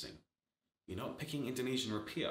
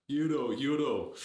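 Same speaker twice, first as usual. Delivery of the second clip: put-on slow, loud German accent shouting the word; hammed-up German CFO barking; bad German accent; 5 semitones higher, 8 dB louder, slower than usual. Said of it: "That's not a very good German accent".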